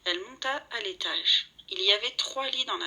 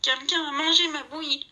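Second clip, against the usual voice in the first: higher whiny little bear voice; Baby Bear's little voice; 7 semitones higher, 3 dB louder than usual. A narrator's reading. Baby Bear finds his porridge gone, whiny high voice. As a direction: whimper it like a small cub. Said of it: "gémit Bébé d'une petite voix".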